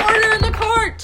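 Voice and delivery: loud, high judge's order; judge's call. Retold or imitated. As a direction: bang it out like a judge. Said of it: "the judge's hammer that goes".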